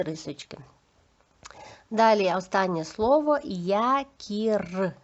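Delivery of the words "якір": "якір" slow, split by syllable with a trilled final R; teacher segmenting "якір"; sound-by-sound demo. Shown as a demonstration. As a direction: draw out "якір" to a long rolled ending. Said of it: "зробимо звуковий аналіз слів".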